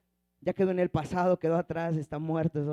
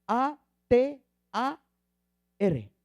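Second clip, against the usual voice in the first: letter by letter, slowly, with pauses; slow, separated letters, dropping on the last; spelled out; 3 semitones higher, slower than usual. Shown as a demonstration. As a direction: spell it out slowly for note-takers. Spelling out the Hebrew word.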